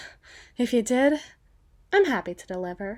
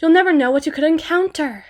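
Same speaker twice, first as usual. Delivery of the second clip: higher, projected warning call; loud pointed warning; 6 semitones higher, 9 dB louder than usual. Acted. Escort warning a night-time walker, loud and pointed. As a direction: call it out with raised voice.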